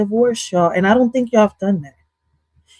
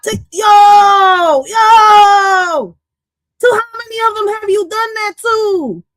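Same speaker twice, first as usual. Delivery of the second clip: shocked high yell; 10 semitones higher, 5 dB louder, slower than usual. Real, not acted. Streamer yells at [name] about his confession, high and loud. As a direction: shriek the question at him.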